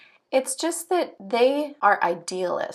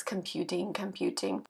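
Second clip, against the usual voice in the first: quiet computer murmur, second word dropping much lower; 3 semitones lower, 10 dB quieter than usual. Acted. Talk imitating a computer of zeros and ones, quiet computer voice. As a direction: process it softly like a machine. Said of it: "sound like this alien or a computer".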